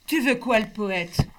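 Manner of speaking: loud, hard challenge. Acted. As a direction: hit it like a fist. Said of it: "Ma voix, dure comme un poing".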